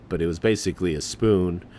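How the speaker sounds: ordinary talk, nothing marked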